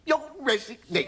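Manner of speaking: high, incredulous question; astonished. Acted. Host hearing the major will resign, astonished. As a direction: echo it back in high-pitched disbelief.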